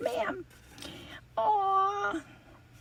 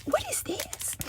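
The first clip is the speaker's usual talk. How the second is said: whisper; 9 semitones higher, 8 dB quieter than usual. Real, not acted. Curious whisper at a gift.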